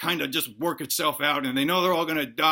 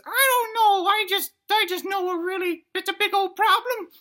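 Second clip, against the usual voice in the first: high, squeaky scared little dino; loud falsetto worry from a tiny dinosaur; little dinosaur child; 4 dB louder than usual. Acted. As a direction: squeal it like an anxious kid. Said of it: "And he says".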